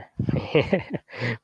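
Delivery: short laugh at his own boast; amused, self-deprecating chuckle; self-mocking laugh. Real, not acted. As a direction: laugh it off at yourself.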